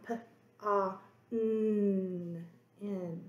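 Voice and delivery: "p, ah, n" each held apart, the "n" hummed; stretched sounds. Shown as a demonstration. Teacher stretching out the word pond. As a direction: hum out the "n". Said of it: "The next sound I hear in pond".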